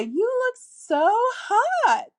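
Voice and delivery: high, slow, lilting and loud; flirty lilting tone. Shown as a demonstration. To a guy at singles group, high playful lilt. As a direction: sing it up playfully, stretching hot. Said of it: "by using your intonation of your voice".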